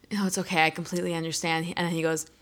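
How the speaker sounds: quietly setting up a story; calm stranger. Retold or imitated. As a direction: play it as a composed, understanding bystander. Stranger's calm retold setup before outburst.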